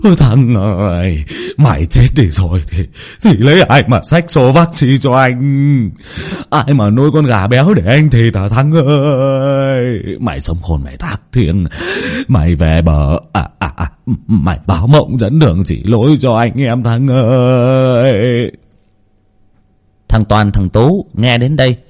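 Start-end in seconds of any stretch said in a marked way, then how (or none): 0.0-1.2 slow, stretched mock wail on "ôi Thắng ơi"; theatrical slow funeral wail that is plainly put on; fake wailing. A narrator's reading. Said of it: "miệng cũng ngoác ra mà gào lên". 1.6-18.5 staged graveside wailing to fool the crowd; fake wailing. A narrator's reading. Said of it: "miệng cũng ngoác ra mà gào lên".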